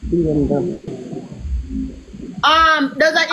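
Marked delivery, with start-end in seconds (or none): 0.1-0.8 mock impressed. Mocking repeat of brag.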